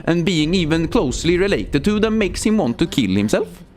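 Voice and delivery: high, hammed-up Norwegian accent; mock Norwegian accent. Streamer mocking Norwegians as a turtle, mock Norwegian accent. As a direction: keep up a comic high Norwegian lilt. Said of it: "he talk like this".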